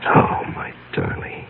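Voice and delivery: breathy tender whisper; slow whispered endearment. Acted. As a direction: whisper it slowly to her. Tender reply to lover.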